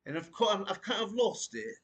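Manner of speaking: high-pitched put-on British accent. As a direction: slip into a playful mock British voice. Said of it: "I had my [name] impression down pat".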